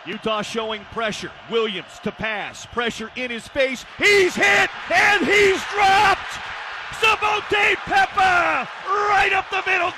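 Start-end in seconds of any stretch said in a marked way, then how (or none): 4.0-9.9 high, loud, stretched-out words; excited sack call. Real, not acted. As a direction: hammer out the big hit slowly. Calling a sack.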